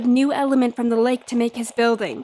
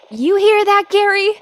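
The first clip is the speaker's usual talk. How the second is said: calling out; 7 semitones higher, 5 dB louder than usual. Acted. Calling out to someone offstage.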